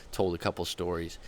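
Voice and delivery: ordinary talk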